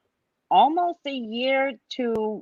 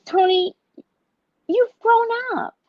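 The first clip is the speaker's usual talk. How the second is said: high, bright-eyed praise to an elder; much higher, cheering praise; encouraging praise; 3 dB louder than usual. Retold or imitated. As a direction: cheer her on. Retold telling aunt she can have braids.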